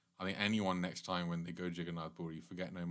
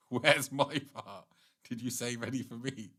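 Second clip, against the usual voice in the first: mock indignant wife; 2 semitones higher, 4 dB louder than usual. Speaker imitates a wife whose husband ate everything, mock indignation. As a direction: imitate a put-out spouse. Imagined wife demanding her share.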